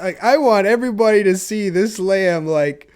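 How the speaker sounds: usual speaking voice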